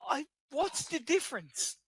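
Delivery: high, lilting leprechaun voice; squeaky little leprechaun. Pretend. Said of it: "Irish, not a leprechaun".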